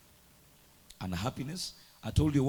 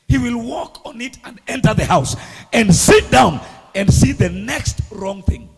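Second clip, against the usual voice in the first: loud, higher preaching shout; preaching at full force; 7 semitones higher, 14 dB louder than usual. Acted. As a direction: thunder it at the congregation. Preaching peak.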